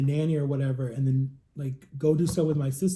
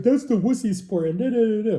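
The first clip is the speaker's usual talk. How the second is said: higher, louder teasing jeer; a schoolyard jeer, lightly imitated; mocking taunt; 6 semitones higher, 4 dB louder than usual. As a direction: mimic the taunting kids. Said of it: "they're like".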